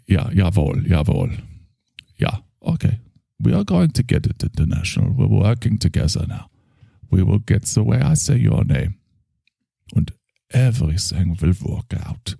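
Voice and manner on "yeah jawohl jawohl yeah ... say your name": put-on German accent. German therapist character.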